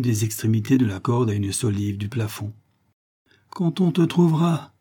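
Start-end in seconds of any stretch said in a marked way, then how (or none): 3.5-4.7 higher, sneering snicker in the voice. Acted. Giant sneers while rigging the rope, higher. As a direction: sneer it like a cruel joke. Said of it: "ricana-t-il".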